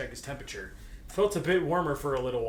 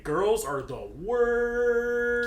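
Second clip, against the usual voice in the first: mock disgust; 8 semitones higher, 4 dB louder, much slower than usual. Mock inner thought of disdain.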